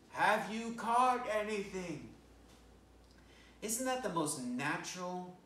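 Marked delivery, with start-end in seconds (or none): 0.1-2.1 high, loud, slow call across the water; calling from the beach. Acted. Jesus calling from the beach to the fishermen, a high slow loud call. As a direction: call out to the boat from the shore. You hear this voice saying.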